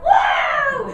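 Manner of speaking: very high loud squawk; parrot shouting. Acted. Parrot calling to the trotting ponies, high squawk. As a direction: screech it like a cheeky parrot. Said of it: "there's Polly calling out".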